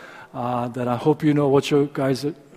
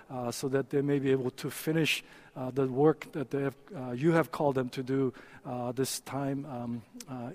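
soft, earnest prayer voice; 9 dB quieter than usual. Real, not acted. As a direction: pray quietly and sincerely. Pastor leads a prayer.

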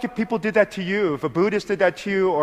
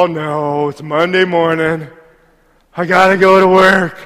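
mock grumbling; 7 dB louder than usual. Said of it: "I don't go".

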